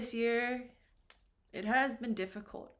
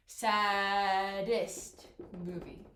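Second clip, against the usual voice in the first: very slow, SADDEST stretched in two parts; drawn out slowly; 3 semitones lower, much slower than usual. Shown as a demonstration. Teacher doubling the consonant for students, drawn out slowly. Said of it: "go through them slowly".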